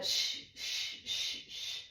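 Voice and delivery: long, steady, repeated "shh"; soothing shush. Shown as a demonstration. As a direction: keep hushing while patting the baby. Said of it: "just down to using the shh".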